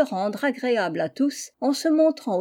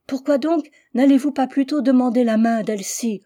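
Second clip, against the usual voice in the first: louder, higher, pleading outburst; desperate cry; 3 semitones higher, 6 dB louder than usual. A narrator's reading. Nell cries out to Jack to propose to Elsie instead, loud and desperate. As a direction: cry it out in despair. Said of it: "m'écriai-je avec désespoir".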